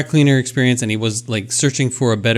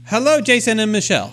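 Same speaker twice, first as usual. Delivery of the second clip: high clipped South African greeting voice; a mock South African accent, pitched up; 8 semitones higher than usual. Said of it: "it's not British, maybe it's South African".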